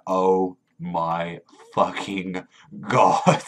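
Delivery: very slow, dragged-out groan of disbelief; imitating stunned dismay. Usopp sees his huge bounty, slow stunned disbelief. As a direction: drag it out like your world just ended. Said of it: "he's like".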